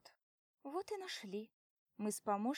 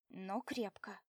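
plain reading voice

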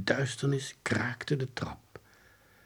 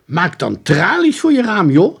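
put-on high, loud boyish suggestion; brash advice; 9 semitones higher, 11 dB louder than usual. A narrator's reading. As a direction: blurt out the obvious fix. Friend loudly gives advice. (luid, brutaal advies)

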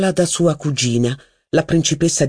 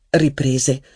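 ordinary delivery, nothing marked

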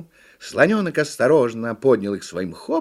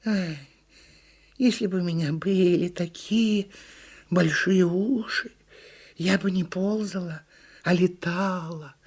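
wistful turtle, higher and unhurried; high, measured turtle longing; sighing turtle; 8 semitones higher than usual. A narrator's reading. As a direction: sigh it out dreamily as a little turtle. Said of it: "вздохнула черепаха".

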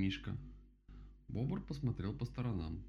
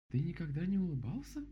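high, astonished question; surprised; 10 semitones higher, 4 dB louder than usual. A narrator's reading. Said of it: "удивилась Мишка". (удивлённый вопрос высоким голосом)

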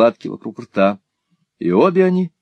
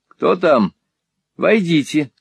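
higher-pitched call to someone at a door; mild, raised-pitch summons; 7 semitones higher, slower than usual. A narrator's reading. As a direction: call out without really shouting. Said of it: "крикнула мисс Лакриви".

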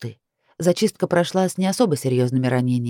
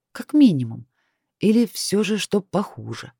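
usual speaking voice

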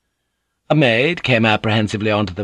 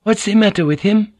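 higher, louder alarmed question; a young wife startled and worried; 12 semitones higher, 5 dB louder than usual. Acted. Fujiko asks Mariko about him, alarmed and higher.

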